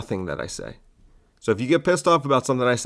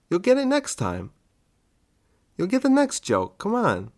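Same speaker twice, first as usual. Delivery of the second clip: put-on talking to a child; 6 semitones higher than usual. Comic to angry listener, condescending baby talk. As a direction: coo at them like a toddler.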